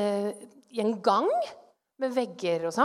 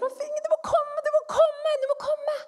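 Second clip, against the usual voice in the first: high-pitched, urgent plea repeated in panic; panikkslagen rop om hjelp; 14 semitones higher than usual. Retold or imitated. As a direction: mock-desperate cry for her husband. Desperate cry to husband in dream. (panisk, lys stemme som roper om hjelp)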